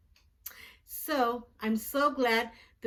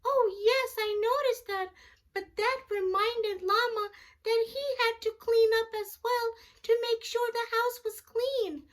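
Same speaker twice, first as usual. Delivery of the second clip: much higher puppet voice for a little friend; Jackson puppet voice; 12 semitones higher than usual. Pretend. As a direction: give the puppet a high childlike voice. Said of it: "our friend Jackson".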